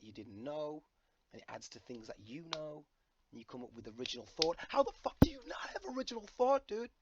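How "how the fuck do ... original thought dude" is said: high-pitched comic outburst; mock disbelief. Vlogger mocking people without original thought, high incredulous outburst. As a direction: jump high into exaggerated incredulity.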